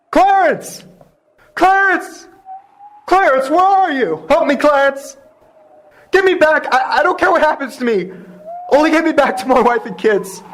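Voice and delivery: high, pleading calls into the night; desperate calling. Acted. George calls out to Clarence, desperate. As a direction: cry out for the angel to come.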